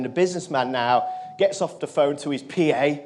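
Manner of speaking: usual speaking voice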